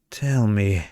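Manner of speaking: ordinary delivery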